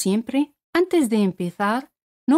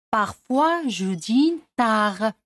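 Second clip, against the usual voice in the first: "Parfois je dîne tard" slow and careful; slow French model; slower than usual. Shown as a demonstration. Teacher slowly models the full sentence for learners. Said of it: "teacher models sentence".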